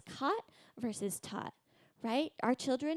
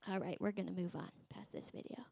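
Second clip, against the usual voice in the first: softer, whispered aside; quiet aside; 4 semitones lower, 8 dB quieter than usual. Real, not acted. Speaker to the congregation, skipping the video, whispered aside. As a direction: drop to a whisper while moving on.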